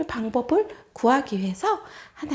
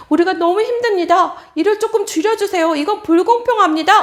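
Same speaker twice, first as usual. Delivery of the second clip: pleading elders; 3 semitones higher, 7 dB louder than usual. Acted.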